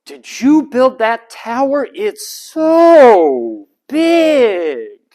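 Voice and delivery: put-on high sing-song voice to a baby; parentese. Shown as a demonstration. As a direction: gush to a toddler, drawing vowels out. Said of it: "they can tell when it's".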